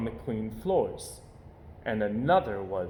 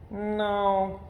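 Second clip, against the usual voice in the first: cub voice; 8 semitones higher than usual. Acted. Cub Brother voiced in story.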